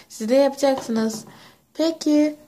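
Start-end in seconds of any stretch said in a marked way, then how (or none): none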